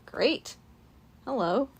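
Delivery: usual speaking voice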